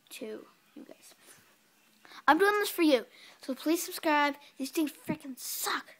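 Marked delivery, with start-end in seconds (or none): 0.1-1.1 whispering. Real, not acted. Whispered aside. 2.3-4.3 loud plea. Real, not acted.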